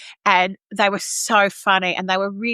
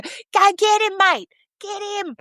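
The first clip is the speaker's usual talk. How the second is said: high shouted heckle with mate; mock yell; 12 semitones higher, slower than usual. Chorus member yelling at the stage action, mock yell. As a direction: egg him on like a rowdy Aussie. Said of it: "she was just coming to".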